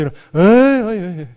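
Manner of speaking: loud, high brush-off "에이, 에이"; a Chungcheong elder's loud, high "에이" refusal; dismissive refusal. Retold or imitated. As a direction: wave it off like a stubborn old man. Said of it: "장인어른 교회 가셔야지".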